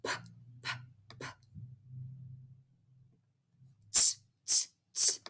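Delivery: "P, p, p" and "Ts, ts, ts" as bare consonant sounds; repeated clipped consonants "p" and "ts", with pauses; isolated letter sounds. Shown as a demonstration. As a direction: puff out each "p" and "ts" on its own, no vowel. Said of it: "let's find our sounds".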